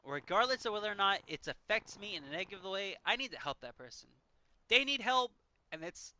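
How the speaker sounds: ordinary delivery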